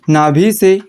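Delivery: slow, broken phrases with long pauses for writing; slow dictation. Shown as a demonstration. Teacher dictating definition to class, slow and paused. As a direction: dictate it so students can keep up.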